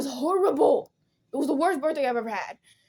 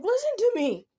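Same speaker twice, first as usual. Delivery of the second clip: high, louder plea to be heard; insistent retold outcry; 12 semitones higher, 4 dB louder than usual. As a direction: demand attention, pitched way up.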